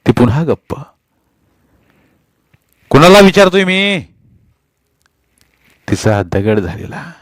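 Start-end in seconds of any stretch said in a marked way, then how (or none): 2.9-4.0 loud, higher furious yell; furious demand. A narrator's reading. Husband yelling at his silent wife, furious. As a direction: demand an answer.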